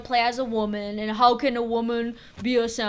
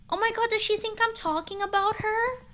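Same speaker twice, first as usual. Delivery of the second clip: incredulous; 11 semitones higher than usual. Real, not acted. Incredulous at viewer.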